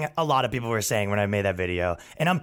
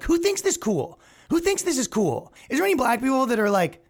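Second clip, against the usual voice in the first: much higher, disbelieving question; incredulous; 10 semitones higher than usual. Real, not acted. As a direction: scoff at it, way up high.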